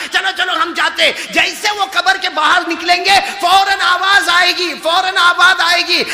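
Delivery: high, loud, ringing preaching; preaching at full force. Acted. Preacher acting out the angels at the grave, full force. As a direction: proclaim the angels' words.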